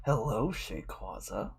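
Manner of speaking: low, slow, very soft menace; imitating a sinister child, hushed and drawn out; sinister kid. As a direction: creep it out quietly with a sinister little smile. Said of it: "he had a sinister little smile".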